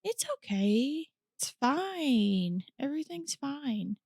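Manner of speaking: high, soft, drawn-out "I'm fine"; putting on a brave face. Real, not acted. Host brushing off sympathy over her dead cat, brave-faced. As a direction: softly insist all is well, pitch swooping. Reassures after sad cat story.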